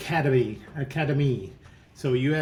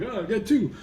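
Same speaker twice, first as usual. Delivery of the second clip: a mock New York accent, pitched higher; 7 semitones higher than usual. Professor finding his second cigarette, in a mock New York accent. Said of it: "I'm gonna do his accent".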